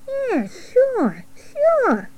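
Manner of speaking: high, thin little voice; rat. Acted. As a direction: squeak it out as a rat. Rat figure replies.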